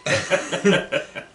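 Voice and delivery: bursts out laughing at his own story; loud open laugh, pitch jumping up; big real laugh. Real, not acted. Laughing while telling friends about the cognac.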